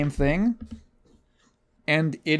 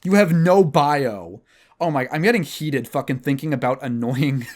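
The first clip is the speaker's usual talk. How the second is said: normal talking voice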